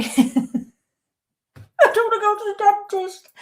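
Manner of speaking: put-on high, slow little-kid whine; childish whine. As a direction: whine it like a scared five-year-old. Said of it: "I'm fifty-one".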